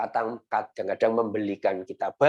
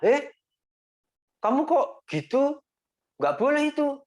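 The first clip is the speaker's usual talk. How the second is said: much higher mock-scolding parent voice. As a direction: scold the kid like a stern mom. Parent scolding child, acted.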